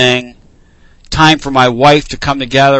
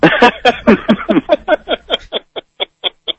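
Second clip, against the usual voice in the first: high rolling laugh; 9 semitones higher than usual. Real, not acted. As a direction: let the laugh run out. Host laughing.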